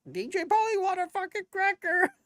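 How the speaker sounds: very high squawking parrot voice. Pretend. Joking to stream viewers, a shrill hammed-up parrot squawk. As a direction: screech it like a pet parrot wanting a cracker.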